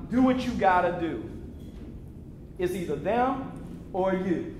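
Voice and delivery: lightly voiced father, pitched low; a hint of a low-voiced father; imitated father. Father's advice voiced in story.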